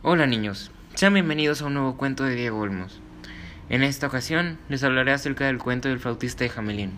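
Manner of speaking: put-on cheerful host welcome for kids; kids show host. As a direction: greet the children, bright and friendly. Host welcomes children to story.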